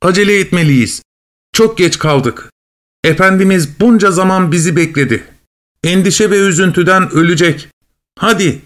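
high-pitched, pressing cat voice; urging cat. Acted. Cat urging the dog home to their worried master, pressing.

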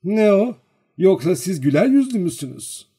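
high, loud teasing question; mocking. A narrator's reading. Girl teasing the lingering servant, mocking. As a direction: needle him mockingly. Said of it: "alaylıca konuştu".

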